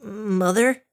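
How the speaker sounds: high, uneasy little call; nervous. A narrator's reading. Dinky sees the blue glow and calls mother, nervous. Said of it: "she shrunk nervously".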